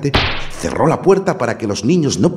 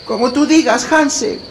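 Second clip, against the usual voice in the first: put-on resigned little girl; 5 dB louder than usual. A narrator's reading. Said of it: "se resignó la pequeña".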